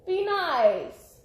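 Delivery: loud, high call, falling away; scolding the cats. Real, not acted. Vlogger breaks off to tell the fighting cats off, scolding. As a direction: call out sharply to pets.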